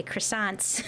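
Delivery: croissants as kruh-SAHNTS, American style. Shown as a demonstration. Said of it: "As Americans say".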